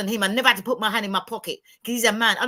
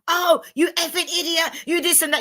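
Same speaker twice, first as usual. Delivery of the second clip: mock shouting; 6 semitones higher than usual. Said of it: "I shouting at him".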